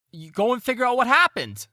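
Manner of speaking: high, loud shouted order; yelling out. Acted. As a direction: holler the order across to the other boat. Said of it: "this woman that was yelling out".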